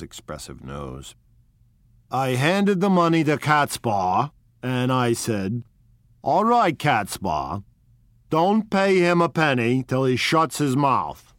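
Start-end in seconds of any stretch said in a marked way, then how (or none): 2.1-11.3 put-on higher, louder old-time gangster voice; un boss di strada che racconta, alzando la voce; Wolfsheim. Wolfsheim tells his story.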